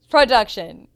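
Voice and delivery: loud, quick repeat in one go; short, forceful address to the producers; emphatic call-out. Retold or imitated. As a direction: say it loudly as a single punchy word. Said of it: "I said".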